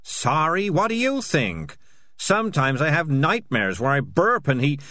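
loud, high-pitched defensive confession; frantic guilty outburst. Acted. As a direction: blurt it all out, protesting your innocence. Cat's agitated answer, cut off.

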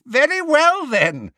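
high, loud manic menace; 疯狂威胁; mad Bellatrix. A narrator's reading.

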